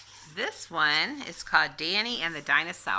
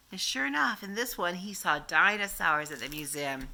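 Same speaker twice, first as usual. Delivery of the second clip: plain reading voice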